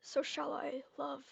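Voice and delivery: breathy secret whisper; conspiratorial whisper to her husband. Acted. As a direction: hush it so no one hears.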